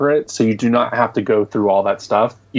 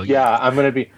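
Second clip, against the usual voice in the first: usual speaking voice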